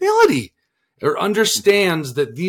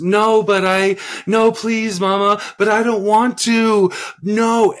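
mock child wailing no, higher and louder; whiny child protest; 9 semitones higher, 6 dB louder, slower than usual. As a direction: imitate a child refusing everything, loud and whiny. Daughter's all-day refusals imitated.

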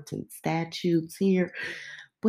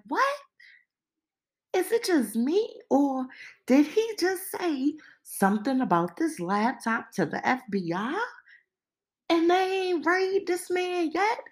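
high-pitched astonished questioning; stunned disbelief; 11 semitones higher than usual. Retold or imitated. Hearing about the laptop, high stunned disbelief. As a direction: squeak it out in shock. Retelling own shocked reaction.

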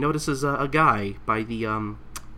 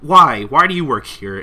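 exasperated question; 4 semitones higher, 10 dB louder than usual. Retold or imitated. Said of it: "And I just".

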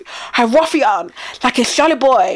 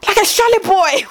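higher, hammed-up scolding mum; mock Nigerian mum; 8 semitones higher than usual. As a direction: play the mum scolding her son, higher.

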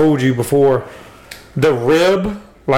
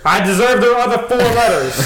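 high, laughing plea to the camera; 8 semitones higher than usual. Real, not acted. Addressing girlfriend through camera, laughing.

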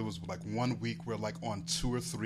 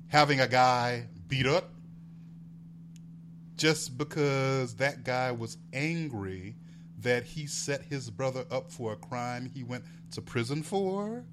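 ordinary talk

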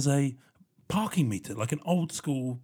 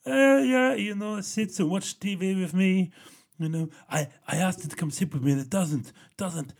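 imitating dad; 5 semitones higher, 4 dB louder than usual. Dad telling his child about the cat, imitated higher. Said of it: "He was like".

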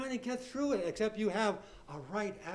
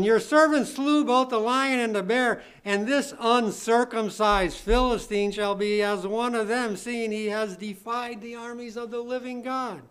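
loud, emphatic Bible reading; declaimed scripture reading; 4 semitones higher, 6 dB louder than usual. Real, not acted. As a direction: proclaim the verse to the congregation. Reads scripture aloud with force.

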